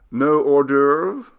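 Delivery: loud, slow, incredulous query; a hint of a haughty steward; surprised steward. A narrator's reading.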